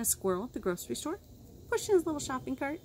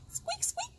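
much higher and softer, squeaky; mouse squeak; 6 dB quieter than usual. Acted. Reading to kids, voicing the mouse, high squeak. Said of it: "said Mouse".

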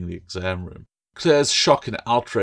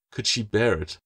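ordinary delivery, nothing marked

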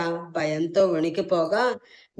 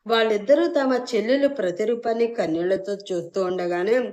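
ordinary delivery

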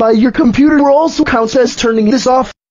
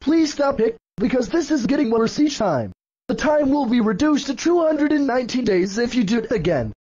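ordinary talk

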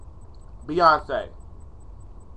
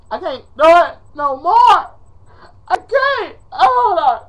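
high, loud melodramatic wail; hammed-up despair, pitch soaring; dramatics; 9 semitones higher, 7 dB louder, slower than usual. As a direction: wail it like you can't take any more. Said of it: "enough of my dramatics".

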